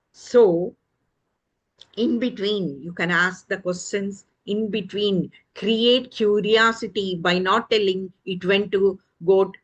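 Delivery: ordinary talk, nothing marked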